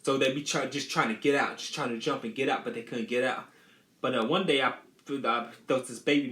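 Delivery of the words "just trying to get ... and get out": ordinary talk, nothing marked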